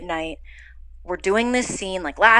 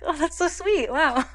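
gushing, touched; 9 semitones higher than usual. Retold or imitated. Touched by Hugh coming in.

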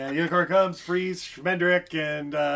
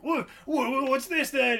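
stammering, higher startled voice; flustered captain; 8 semitones higher than usual. Retold or imitated. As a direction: stutter like a caught-out bandit. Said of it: "Captain Cully's basically like".

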